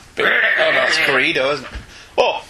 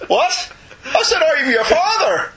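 hammed-up high wail, much higher; mock distraught Luke; 11 semitones higher, slower than usual. Luke hears the father reveal in mock wailing. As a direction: fake sobbing disbelief.

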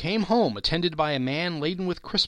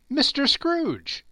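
much higher, slow playful reveal; wife voiced; 13 semitones higher, 4 dB louder, slower than usual. A narrator's reading. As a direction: draw out the name teasingly. Wife guesses the old friend.

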